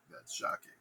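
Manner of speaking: flat, low, voiceless mutter; utterly unbothered, barely breathed; deadpan. Acted. Macbeth reacting to the murder news, deadpan mutter. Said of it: "Macbeth's like".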